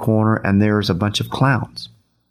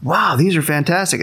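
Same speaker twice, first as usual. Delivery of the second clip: mildly acted, higher-pitched wow; retold amazement; amazed; 6 semitones higher than usual. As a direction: lift the pitch in impressed wonder. Retold amazed reaction to balloons.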